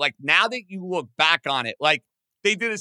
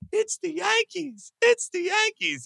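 much higher, giddy mother's voice; imitating his mother. Retelling Jeter's mother at the draft, high excited mimic. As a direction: echo the proud mother, pitched way up. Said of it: "you keep hearing his mother say".

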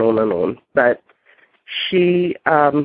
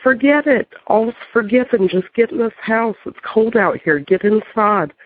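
much higher, urgent calling in; eager welcome; 8 semitones higher than usual. Retold or imitated. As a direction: wave her in from the cold. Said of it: "And I said".

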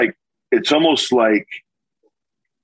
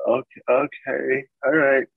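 soft, slow, higher sleepy mumble; half-asleep girlfriend; 5 semitones higher, 3 dB quieter, slower than usual. Retold or imitated. Girlfriend woken late at night by his call, half asleep. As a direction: lightly imitate someone barely awake and humouring you. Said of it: "she's, like, half asleep".

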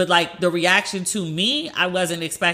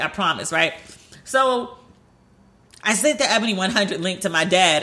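normal talking voice

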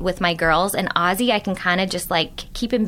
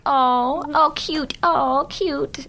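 imitating a toddler; 6 semitones higher, much slower than usual. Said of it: "he'd be like".

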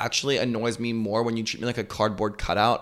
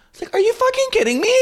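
much higher, fast, squeaky disbelief; exasperated; 4 dB louder, much faster than usual. Retold or imitated. To friends calling her rude, a squeaky incredulous shriek. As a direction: shriek the outrage in a put-on voice.

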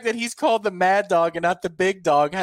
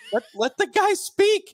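much higher, exasperated plea; mock exasperation; 14 semitones higher than usual. Listener reacting to host cutting off caller, high mock exasperation. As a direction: squeal it in mock frustration. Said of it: "you're just like".

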